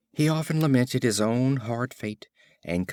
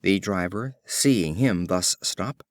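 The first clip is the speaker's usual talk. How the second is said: plain reading voice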